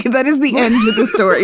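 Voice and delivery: giggling resignation; laughing. Real, not acted. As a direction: say it through laughter. Laughs through the repeat.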